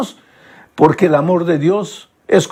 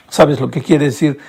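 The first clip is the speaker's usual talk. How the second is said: ordinary talk, nothing marked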